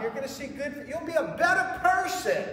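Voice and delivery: high, put-on voice of the sly serpent; tempting serpent. Preacher voices the serpent luring Eve, high coaxing voice. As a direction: coax her like a smooth tempter.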